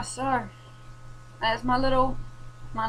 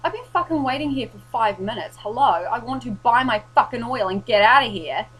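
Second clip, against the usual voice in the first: retold angry outburst; 3 semitones higher, 9 dB louder than usual. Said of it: "And I was like".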